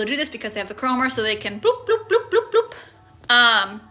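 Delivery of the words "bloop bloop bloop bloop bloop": high playful bloop noises; bloop sounds. Retold or imitated. A vlogger voicing teleport noises, playfully high. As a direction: chirp each bloop up high.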